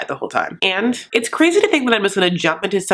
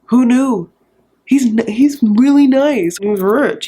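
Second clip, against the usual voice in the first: louder, higher, eager sell of the groom; mock gushing praise; 3 semitones higher, 4 dB louder, slower than usual. Brother pitching Benjamin to the dad, mock eager gush. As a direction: hype him up like a proud salesman. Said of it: "Or if it's like".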